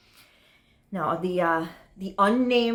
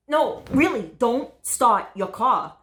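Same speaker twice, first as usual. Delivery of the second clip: insistent, raised-pitch warning; urgent warning; 4 semitones higher, 5 dB louder than usual. Acted. Date warning about the car bomb, urgent and insistent. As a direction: stop them with a firm, higher voice.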